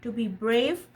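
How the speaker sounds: gentle storybook read-aloud; lightly animated picture-book narration; reading to a child. A narrator's reading. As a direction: read it like a bedtime story to your son. Reads picture book to son.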